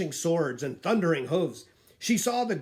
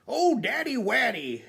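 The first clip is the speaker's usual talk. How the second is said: shouted; 8 semitones higher than usual. A narrator's reading. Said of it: "shouted Sir Horace".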